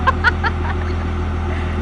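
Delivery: short jittery laugh; nervous laughter. Real, not acted. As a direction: laugh off the nerves. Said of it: "That's nervous laughter".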